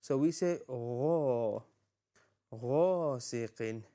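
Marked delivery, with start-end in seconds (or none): 0.7-1.6 gha rounded and held long, toward "gho"; slow, heavy gha; full-mouth ghain. Shown as a demonstration. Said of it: "Ghain is a full mouth letter". 2.5-3.8 غاسق with a long, rounded "gho"; غاسق starting on a full-mouthed gh; full-mouth ghain. Shown as a demonstration. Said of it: "Ghain is a full mouth letter".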